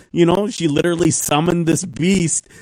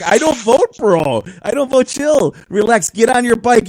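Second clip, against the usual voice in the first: louder, higher calming the guy down; talking back to the cyclist, raised voice; retold pushback; 7 semitones higher, 5 dB louder than usual. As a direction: tell him to chill, raised and firm. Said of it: "I'm like".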